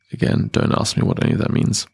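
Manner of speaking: usual speaking voice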